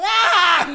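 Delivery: loud hammed-up moan; mock sex moan, loud. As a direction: belt out a fake moan. Mom's moans in sex anecdote.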